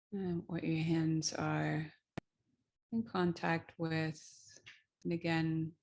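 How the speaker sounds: unhurried, gentle guiding with long pauses; guided meditation. Real, not acted. Teacher guiding listeners into the feet and hands, calm meditation voice.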